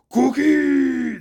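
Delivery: loud, high monster cry; Cookie Monster voice. Acted. Barbarian Atlas crying out for cookies, loud monster voice. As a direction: bellow the word like a hungry monster. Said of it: "we have one modeled after Cookie Monster".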